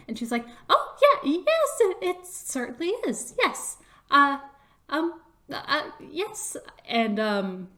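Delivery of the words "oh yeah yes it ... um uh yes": high, loud burst fading into stammers; squeaky, flustered over-agreeing; nervous. Acted. Chris questioned about a magical item, nervous and stumbling.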